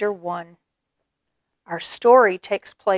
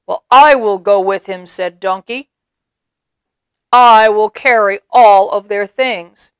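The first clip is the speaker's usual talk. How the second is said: louder, firm promise; brave donkey; 11 dB louder than usual. A narrator's reading. Donkey offering to go, louder and firm. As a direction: promise it boldly.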